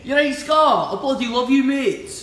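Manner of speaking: loud, high-pitched Scottish shout; Scottish accent. Pretend. Singer hailing Scott who is watching, boisterous mock Scottish accent. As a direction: holler it in a hammed-up Scottish accent. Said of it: "Scottish accent".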